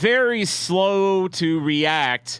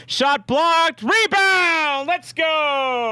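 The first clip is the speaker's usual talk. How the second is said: excited cheer; 12 semitones higher, much slower than usual. Real, not acted. Fan reading a live goal notification, excited shout. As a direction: cheer the rebound as it happens.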